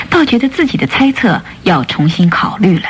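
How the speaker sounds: ordinary delivery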